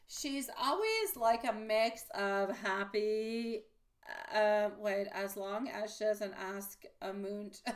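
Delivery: usual speaking voice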